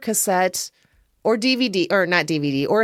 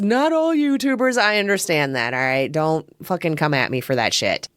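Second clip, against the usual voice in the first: normal talking voice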